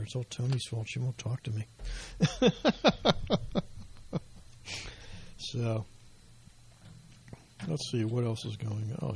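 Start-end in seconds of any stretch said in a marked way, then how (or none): 1.7-5.3 short amused laugh; a light chuckle at his own joke. Real, not acted.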